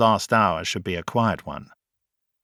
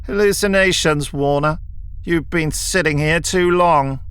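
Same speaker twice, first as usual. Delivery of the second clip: higher, louder sneer; 6 semitones higher, 3 dB louder than usual. A narrator's reading. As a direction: sneer down at him. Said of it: "Kelman sneered".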